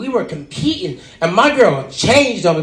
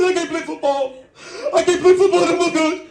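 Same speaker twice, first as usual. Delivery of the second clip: much higher, hammed-up wailing player; Booby Miles impression; 10 semitones higher, faster than usual. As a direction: whine it to the coach in mock tears. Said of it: "Friday Night Lights and Booby Miles".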